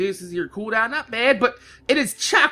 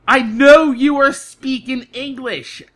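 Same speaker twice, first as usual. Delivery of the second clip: deliberate, word-by-word retold plea; slow, emphatic explaining; 3 dB louder, slower than usual. Half-deaf customer explaining to a clerk, slow and emphatic. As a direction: spell it out very slowly.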